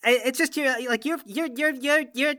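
high, tripping over the same word; stammer, too starstruck to finish; flustered stammer. Acted. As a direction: starstruck fan stammering in front of his hero. Fan stammers meeting his hero.

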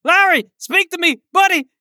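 mock-frantic shouting of his name; calling out. Player shouting to his fallen teammate, hammed-up high calls.

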